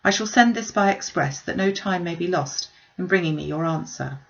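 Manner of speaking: ordinary delivery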